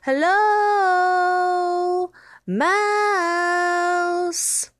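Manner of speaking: drawn-out high call. Acted. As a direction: call across the snow. Mice calling for lost mouse.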